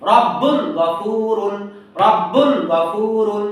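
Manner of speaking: রব্বুন গফুরুন stretched, clear n; slow recitation. Shown as a demonstration.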